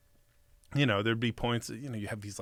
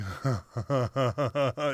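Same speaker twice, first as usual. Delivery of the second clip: giggling laugh. Retold or imitated. Acts out getting giggles.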